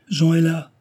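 put-on young woman shouting to her worried family below; joyful shout. A narrator's reading. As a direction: shout the happy news upward. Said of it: "crie-t-elle".

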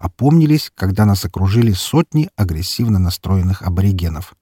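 plain reading voice

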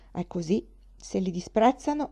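plain reading voice

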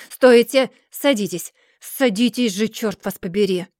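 loud burst, easing into exasperation; shouted orders. A narrator's reading. Mr. Dorrit orders John back, shouting then exasperated. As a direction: order him about angrily, loudest at first. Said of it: "закричал мистер Дорит".

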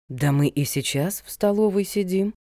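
mildly puzzled; a touch of confused protest; bewildered. A narrator's reading. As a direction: say it slightly at a loss. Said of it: "растерянно сказала Ольга Викторовна".

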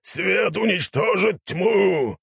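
high, drawn-out zealot's cry; startled old warrior's slow battle cry; yelling. A narrator's reading. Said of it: "заорал он".

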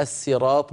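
"الصراط" with a light, plain s; thin the first sound of "الصراط" to s; original sīn. Shown as a demonstration. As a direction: say "الصراط" as as-sirāṭ. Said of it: "الأصل أنها الصراط بالسين".

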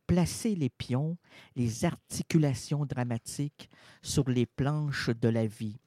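slow, low, grave reading; hushed poem read to the hall; solemn poetic recitation. Acted. Poetic spoken interlude.

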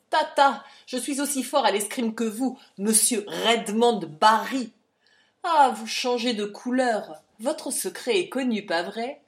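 loud, taunting dismissal; mock his threat and crow over exposing him; scornful challenge. A narrator's reading. Captain scorns and exposes hero.

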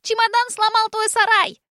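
put-on high, loud gleeful child; boy telling dad about the shed, gleeful; excited child voice. A narrator's reading. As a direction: tattle with glee like a kid. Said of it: "радостно заорал чей-то ребёнок".

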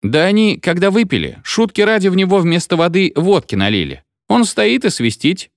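high-pitched, lightly voiced youngster; amused, piping explanation; giggly little figure. A narrator's reading. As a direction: tell it as a gleeful kid, lightly acted. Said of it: "Фигурка хихикнула и сказала".

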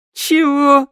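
loud, high-pitched scoff; indignant snort. A narrator's reading. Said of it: "фыркнула Шныряла". (возмущённо фыркнуть)

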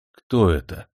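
usual speaking voice

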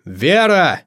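calling out. A narrator's reading. Host summoning the missing witch Vera, calling out. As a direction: call her from across the house. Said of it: "прикрыл глаза и позвал".

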